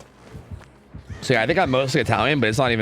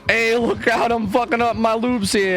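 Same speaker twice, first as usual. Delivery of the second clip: much higher, hammed-up New York Italian bickering; New York Italian accent; 7 semitones higher than usual. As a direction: argue with yourself like a Brooklyn wiseguy. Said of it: "my dumb New York Italian accent thing".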